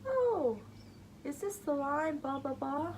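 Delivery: high, bright mock Irish accent; a light take on an Irish accent. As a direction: imitate an Irish tourist asking about the line. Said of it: "guess what accent they were speaking".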